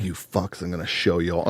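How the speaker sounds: under-the-breath vow to prove them wrong; muttered defiance. Retold or imitated. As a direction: mutter it like a grudge. Inner fight-talk against imagined foes.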